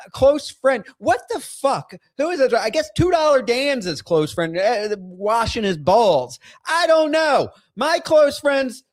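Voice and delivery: venting, voice pushed up with anger; worked-up rant; fired up. Real, not acted. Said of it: "I'm getting fired up".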